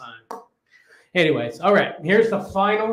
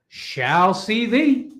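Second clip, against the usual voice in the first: mock archaic; 4 semitones higher, slower than usual. Archaic playful sign-off.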